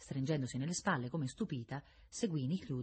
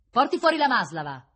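loud, high command; raised, carrying voice; calling out an order; 8 semitones higher, 10 dB louder than usual. A narrator's reading. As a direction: order it across the room.

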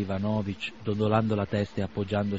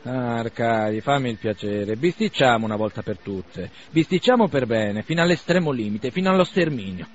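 jumping up, provoking; 3 semitones higher, 8 dB louder than usual. A narrator's reading. Bazarov leaping up to goad Arkady into a fight, building loud. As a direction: egg him on, louder each line. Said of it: "Saltò su Bazarov".

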